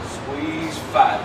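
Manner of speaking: normal talking voice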